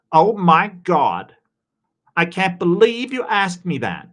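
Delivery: put-on a weak stab at an American Valley Girl accent; attempted Valley Girl. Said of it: "Can you speak in American Valley Girl".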